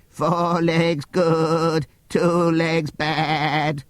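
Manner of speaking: high, slow drawn-out bleat; sheep bleating. A narrator's reading. Sheep bleating their slogan at the meeting. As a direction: bleat each word like a sheep. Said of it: "the sheep had taken to bleating".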